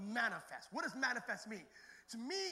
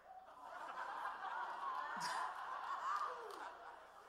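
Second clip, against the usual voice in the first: long wheezing laugh; 10 semitones higher than usual. Real, not acted. Laughing at his own story.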